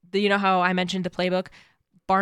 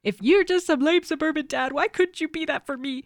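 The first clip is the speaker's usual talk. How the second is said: dramatic quote; 9 semitones higher than usual. Acted. Quoting a dramatic show line.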